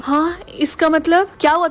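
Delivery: usual speaking voice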